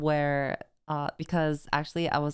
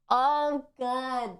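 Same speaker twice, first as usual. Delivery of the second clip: shocked gasp; 8 semitones higher than usual. Real, not acted. Reacting to phone camera spying.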